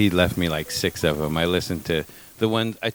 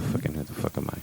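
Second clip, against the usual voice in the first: whispering; 6 semitones lower, 9 dB quieter than usual. Acted. Mock muttered self-question.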